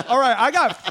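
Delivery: usual speaking voice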